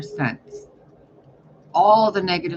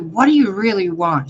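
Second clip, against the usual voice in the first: loud, higher, pressing question; raised voice, emphatic mock exasperation; exasperated demand; 4 semitones higher, 8 dB louder than usual. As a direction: demand an answer from a pest. Exasperated question to shadow side.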